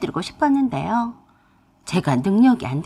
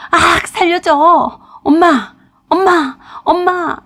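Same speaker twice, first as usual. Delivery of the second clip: screaming for help, much higher and louder; terrified scream; 8 semitones higher, 8 dB louder, slower than usual. Acted. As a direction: shriek for mom in a nightmare. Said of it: "저도 모르게 악을 질렀습니다". (악몽 속에서 엄마를 부르며 비명)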